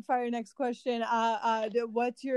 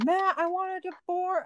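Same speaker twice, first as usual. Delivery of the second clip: lightly raised, slow mock yell; 8 semitones higher, slower than usual. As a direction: call it across the room, half joking. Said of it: "she'll just yell".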